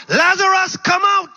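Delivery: high, loud call of authority; ringing command to rise; commanding shout. Acted. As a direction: summon him out with full force. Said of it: "Jesus says".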